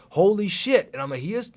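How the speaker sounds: loud, high astonished exclamation; amazed discovery. Retold or imitated. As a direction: burst out with retold amazement.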